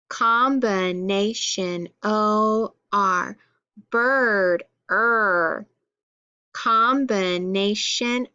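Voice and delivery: key word, stretched sound, then its spelling; measured classroom recitation with drawn-out sounds; sound-card drill. Shown as a demonstration. Teacher going through sound cards with pupils, drilled recitation.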